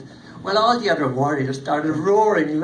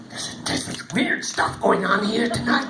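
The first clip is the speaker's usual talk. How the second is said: ordinary delivery, nothing marked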